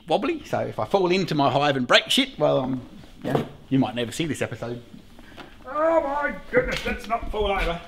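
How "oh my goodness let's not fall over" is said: mock alarm. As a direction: squeak out a playful fright while wobbling. Mock alarm on wobbly ladder.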